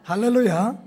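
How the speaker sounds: ringing, rising hallelujah; loud hallelujah. Real, not acted. Pastor greets the congregation, loud rising 할렐루야. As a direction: call out 할렐루야 loudly with a rise. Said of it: "서로 한번 인사하겠습니다".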